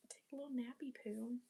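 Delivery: quick hushed whisper; whispering about a nap. Real, not acted. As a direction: breathe it out like a secret. Sleepy aside to camera.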